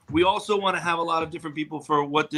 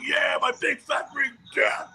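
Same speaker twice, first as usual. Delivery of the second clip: high, slow, drawn-out yell; mock drunken holler; 9 semitones higher, slower than usual. Man re-enacting his drunk reply to a fan, hollering. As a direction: bellow back like a sloppy drunk.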